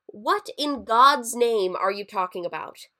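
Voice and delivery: loud, baffled demand; exasperated outburst. A narrator's reading. Man to a strange intruder, loudly exasperated. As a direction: burst out in disbelief.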